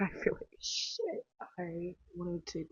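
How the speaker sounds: weak hungover whisper; slow unvoiced mumble; groggy whisper. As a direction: croak it like you barely woke up. Hungover at four a.m.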